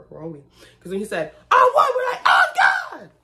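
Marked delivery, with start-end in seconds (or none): 1.5-3.1 very high, loud shrieked "Oh, God!"; excited shout. Retold or imitated. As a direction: shriek like a starstruck fan. Retold shouting at concert.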